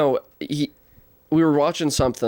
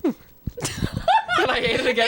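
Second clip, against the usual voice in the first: high, laughing through words. Real, not acted. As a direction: squeal it while cracking up. Laughing while telling.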